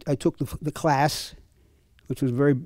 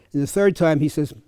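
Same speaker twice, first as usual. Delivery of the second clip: normal talking voice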